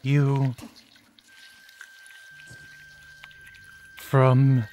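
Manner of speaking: faint, broken whisper of a dying doll; barely-there doll voice, word by word. Acted. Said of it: "I hear a faint voice".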